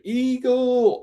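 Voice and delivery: high, drawn-out imitated call; playful battle cry. Friend about to jump on friend's back, high gleeful call. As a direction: call it out like a silly launch signal.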